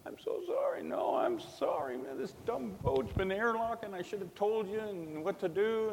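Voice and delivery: high, breaking whispered sobs of sorry; retelling a weeping apology to a friend; crying apology. Retold or imitated. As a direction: choke out the apology through tears. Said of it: "We're actually crying".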